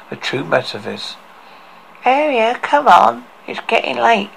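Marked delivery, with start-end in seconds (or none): 2.0-4.3 put-on higher, boyish nervous turtle; young boy voice, urging a friend; Torty's boyish voice. A narrator's reading. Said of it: "with a boyish voice".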